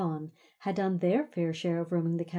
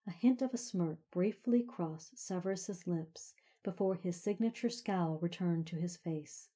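ordinary delivery

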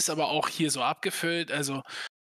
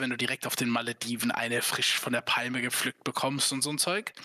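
ordinary talk

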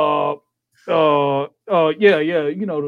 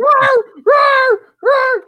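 high, loud mock dog roars; shrill playful barking yell; dog roar imitation; 6 dB louder, much slower than usual. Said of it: "They're over here just".

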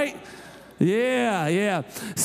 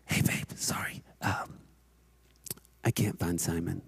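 low, hushed, sheepish admission; hesitant confession; 9 dB quieter than usual. Retold or imitated. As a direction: break bad news almost under your breath. Wife hesitantly breaks bad news.

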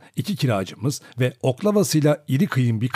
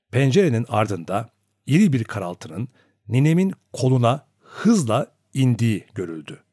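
ordinary delivery, nothing marked

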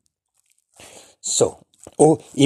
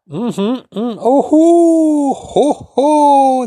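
high, loud, slow surprised hoo sounds; stunned hooting; 14 semitones higher, 8 dB louder, much slower than usual. Shown as a demonstration. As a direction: gasp and hoot in disbelief.

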